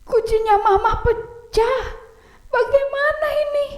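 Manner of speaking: high child voice, giggly mock panic; panicking boy. Oni panics over mama's vase, high giggly mock alarm. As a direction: wail it like a scared kid.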